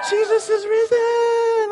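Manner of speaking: high, slow, drawn-out call; Easter proclamation. Real, not acted. Pastor calling the Easter greeting to the church, high and slow. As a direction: ring it out like an Easter shout.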